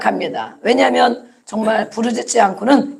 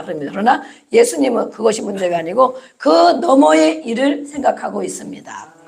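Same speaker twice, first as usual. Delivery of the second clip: ordinary delivery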